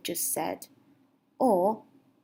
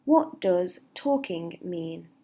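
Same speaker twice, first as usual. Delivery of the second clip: slow clear model; 2 semitones lower, slower than usual. Shown as a demonstration. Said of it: "you could say".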